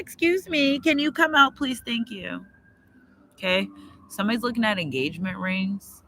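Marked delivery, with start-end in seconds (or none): 0.0-2.4 much higher, sweetly polite voice; mock-polite falsetto to a bee in the coat; polite plea to a bee. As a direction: coax the bee out in a high sugary voice. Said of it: "I was like".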